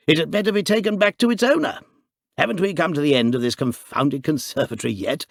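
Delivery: loud, hearty brush-off; jolly, dismissive. A narrator's reading. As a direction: laugh the whole thing off. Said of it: "said the jolly Harris".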